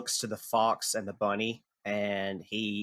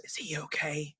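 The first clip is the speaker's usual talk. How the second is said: quiet crocodile voice; 3 semitones higher, 10 dB quieter than usual. Acted. Crocodile asks about Mouse.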